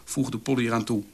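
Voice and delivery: ordinary delivery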